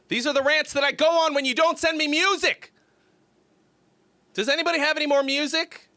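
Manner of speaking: high, loud, exasperated plea; whiny streamer's outburst, played up; mock rant. Mock ranting for want of music.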